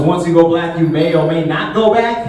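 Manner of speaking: ordinary talk, nothing marked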